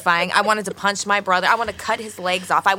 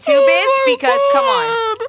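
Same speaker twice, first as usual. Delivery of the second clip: very high, louder exasperated cry; shrill mock outrage; 4 dB louder, slower than usual. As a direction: wail it in fake despair. Exaggerated outrage at dirty house.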